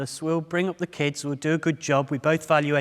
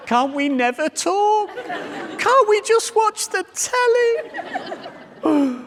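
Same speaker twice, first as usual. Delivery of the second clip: high, loud, slow whine through laughter; laughing mock protest; 5 dB louder, much slower than usual. Husband dreading the talk, whining high through laughter. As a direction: plead against it while cracking up. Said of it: "I'm thinking".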